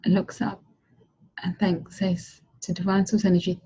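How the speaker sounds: calm, measured storytelling voice; soft guided-vision narration; guided visualization. Acted. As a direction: paint the future scene gently for the listener.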